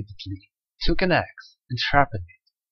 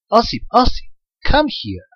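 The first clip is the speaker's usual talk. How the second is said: calling out; 10 semitones higher than usual. A narrator's reading. Daughter calling her husband from the dike: calling out. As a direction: call for your husband over the pond. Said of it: "called out".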